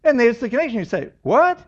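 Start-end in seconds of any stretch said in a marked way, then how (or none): 1.2-1.6 puzzled what. Retold or imitated. Voicing a puzzled listener.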